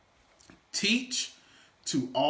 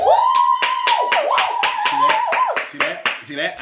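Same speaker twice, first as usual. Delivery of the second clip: much higher and louder, thrilled; excited; 12 dB louder, much slower than usual. Real, not acted. Speaker to audience, thrilled by the cheer. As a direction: show off the crowd's cheer. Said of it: "I feed off of that".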